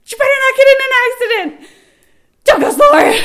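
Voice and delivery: high, full-volume re-enacted yell. Woman in labor to husband racing down the highway, yelling. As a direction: yell frantically at the driver. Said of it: "I'm yelling at Sean now".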